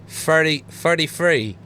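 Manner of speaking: "thirty-three" with F sounds replacing the TH; English accent, F for TH in "thirty-three"; English TH-fronting. Shown as a demonstration. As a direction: say "thirty-three" as "furty-free". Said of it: "They use F R".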